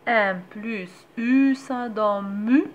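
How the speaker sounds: each sound drawn out, clear and slow like a reading teacher; slow syllable blending. Shown as a demonstration. Teacher showing mothers how to blend m and a vowel, slow and clear. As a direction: sound the syllable out slowly so a child can follow. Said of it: "غنطقها بحال غنطق اي".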